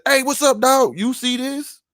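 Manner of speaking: high-pitched chummy hailing; mock friendly greeting. Mocking a greeting nobody would use.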